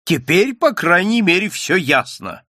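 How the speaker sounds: lightly voiced drunk jeer; a hint of drunken smugness; slurred cynical barfly. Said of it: "пьяным голосом сказал". (пьяным голосом, слегка)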